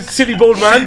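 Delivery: high, loud mimicked child's chant; gleeful mock kid's shout; little kid imitation. Little kid running around repeating mum's insult, gleeful. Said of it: "this little kid is running around".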